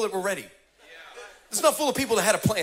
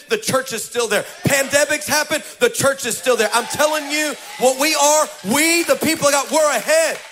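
rising shouted preaching refrain, higher and louder; preaching at full force; 6 semitones higher, 4 dB louder than usual. Acted. Pastor rallying the congregation, preaching full force. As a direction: build the crowd up, louder each line.

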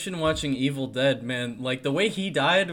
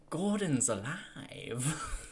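softer, slow, breathy-free dramatic movie line; hammed-up hero line; 2 semitones higher, 8 dB quieter, much slower than usual. Fan quoting a favorite movie line to co-hosts, slow and soft. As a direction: savor the line like a campy film hero. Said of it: "some of the line delivery in this movie".

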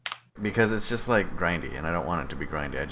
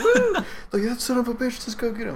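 a huge high whoop, then shouted glee that stays well above the voice; whooping cop cheer; 5 dB louder than usual. Retold or imitated. Retelling cops cheering the getaway driver, whooping high and loud.

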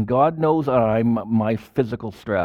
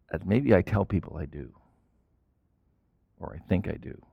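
fading softer and lower to near silence; sinking into a humble murmur; hushed confession; 4 semitones lower, 5 dB quieter than usual. Real, not acted. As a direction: confess it quietly to yourself.

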